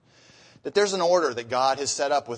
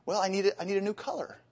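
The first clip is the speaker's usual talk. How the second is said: meek excuse; 7 semitones higher, 4 dB quieter, faster than usual. Retold or imitated.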